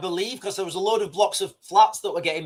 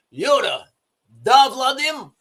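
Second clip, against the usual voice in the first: mock Russian accent; 5 semitones higher, 5 dB louder, slower than usual. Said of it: "messing about with my accents".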